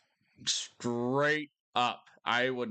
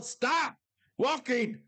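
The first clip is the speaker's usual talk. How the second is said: high, loud yelled order; retold yell; 12 semitones higher, 3 dB louder, much slower than usual. Yelling at tourists walking toward a bear. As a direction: shout up high to make them stop. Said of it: "I would just yell".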